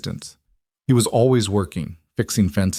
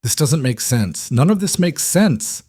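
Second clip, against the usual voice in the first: tearful; 6 semitones higher than usual. A narrator's reading. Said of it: "tears burning her eyes".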